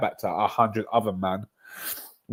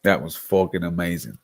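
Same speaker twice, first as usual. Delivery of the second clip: normal talking voice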